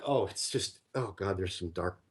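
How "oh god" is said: normal talking voice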